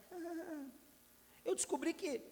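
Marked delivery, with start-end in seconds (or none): 0.1-0.7 put-on high, soft crying whimper of a child; a small tearful ah; imitated toddler whimper. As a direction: mimic a little girl's sob.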